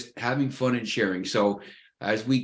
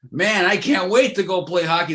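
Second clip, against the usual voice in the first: higher, louder, keen and excited; eager imitation; 5 semitones higher, 4 dB louder than usual. Ex-pro player who can't wait to play hockey, eager and excited. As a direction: sound like a kid itching to play.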